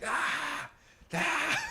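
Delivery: high, strained cries of pain; pained yell. Retold or imitated. Patient in the dental chair yelling in pain. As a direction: cry out like the tooth is snapping. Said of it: "I just remember yelling out".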